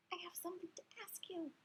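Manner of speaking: quick breathy secret ask; whispered question. Acted. Hamster quietly asking the frog, a quick whisper. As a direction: lean in and whisper it fast. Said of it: "I squeaked".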